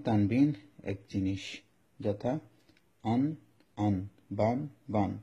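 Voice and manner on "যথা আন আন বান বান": আন and বান read out slowly as syllables; Quran reading demo. Shown as a demonstration. Teacher sounding out tanween examples for students, slow and clear. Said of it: "আমি আর একটু clear করি".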